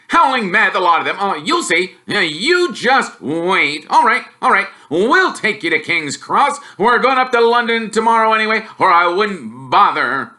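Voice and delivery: mock the boy's wizard school; scornful Uncle Vernon jeering; sneering uncle. Uncle rants.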